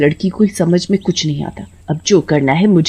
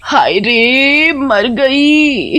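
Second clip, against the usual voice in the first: slow, drawn-out wail of fake pain; hammed-up fake fall cry; 6 semitones higher, much slower than usual. Grandmother fakes a fall to blame the boy, drawn-out wail. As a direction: overact the pain of a pretend fall. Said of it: "गिरने का नाटक करती है".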